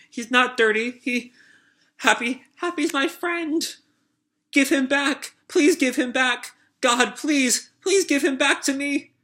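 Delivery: high, lightly voiced child begging; a high child's voice, desperate and pleading; pleading little girl. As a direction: beg like a small girl who lost her pet. Little girl pleading over dead cat.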